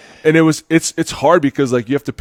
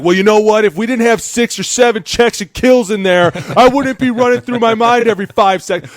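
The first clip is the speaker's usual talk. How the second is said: high, loud heated yelling at the coach; 11 semitones higher, 6 dB louder than usual. Retold or imitated. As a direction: snap back at him, all fired up. Player yelling back at coach.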